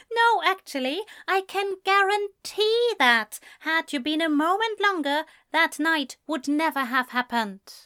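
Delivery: high-pitched, emphatic argument; indignant Ezra. A narrator's reading. Man tells friend that night was chance, indignant. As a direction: press the point in a high voice.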